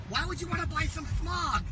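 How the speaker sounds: ranting shout. Acted. Ranting at sign.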